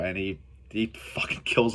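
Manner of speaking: hushed, breathy aside; whispering the gory bit to the viewer. Real, not acted. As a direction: drop to a conspiratorial whisper. Excited recap of a kill.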